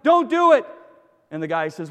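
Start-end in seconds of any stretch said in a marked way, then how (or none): 0.0-0.7 loud, high, urgent call; raised-voice plea from a distance; acted shout. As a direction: shout to stop a jumper. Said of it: "the first fellow shouts".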